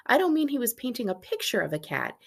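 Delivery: ordinary delivery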